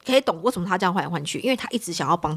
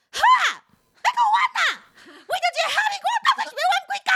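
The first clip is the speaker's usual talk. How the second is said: 极高的尖声快骂; 妈妈在门外尖声斥责; mother's shrill scolding; much faster than usual. Retold or imitated. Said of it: "我妈直接在门外". (妈妈隔着门尖声快速骂吵架的夫妻)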